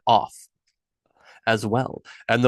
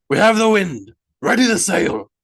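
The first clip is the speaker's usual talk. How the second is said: a ship captain calling out loud and high; shouting; 8 semitones higher, 6 dB louder than usual. A narrator's reading. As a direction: bellow it across the deck to the crew. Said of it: "Harald shouted".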